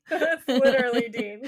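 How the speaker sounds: high, loud words through real laughter; laughing hard. Real, not acted. Host cracking up with co-host over Dean comparison, laughing. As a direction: crack up while talking.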